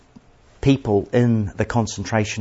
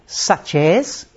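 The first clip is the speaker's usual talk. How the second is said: sarcastic; 4 semitones higher than usual. A narrator's reading. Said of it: "rather sarcastically she says".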